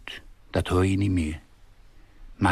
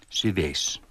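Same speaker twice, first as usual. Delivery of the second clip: ordinary delivery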